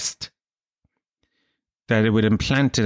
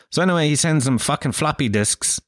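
ordinary talk

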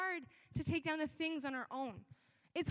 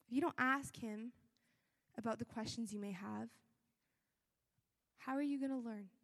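usual speaking voice

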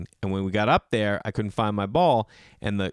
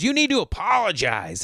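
high, loud scolding demand; hammed-up scolding lady; demanding old woman; 9 semitones higher, 3 dB louder than usual. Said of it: "And the woman goes".

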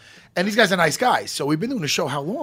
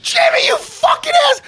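high screaming laugh into a yelled insult; shrieking laugh; 7 dB louder than usual. Real, not acted. Laughing at his cohost's joke, a high shrieking laughing yell.

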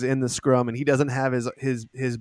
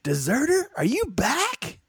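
soldier; 13 semitones higher than usual. Acted. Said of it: "looks back and goes".

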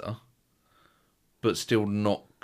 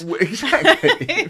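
laughing; 14 semitones higher, 9 dB louder, much slower than usual. Real, not acted. Laughing at the cream joke.

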